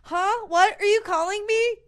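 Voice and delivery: high mock-startled bear dodging the moose; flustered bear. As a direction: squeak it out like a bear faking a phone call. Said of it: "the grizzly bear goes".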